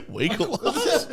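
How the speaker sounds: laughing. Retold or imitated. Mocking mate's sleepy call.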